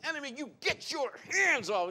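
high, forceful rebuke; rebuking command. Retold or imitated. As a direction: order the enemy off. Rebuking the enemy, declaring.

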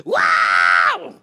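screaming. Retold or imitated. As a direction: let a joyful scream burst out. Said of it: "all I hear is screaming".

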